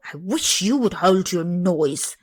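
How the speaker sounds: rudely. Acted. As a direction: snap at the singing boy. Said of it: "said the nurse rudely".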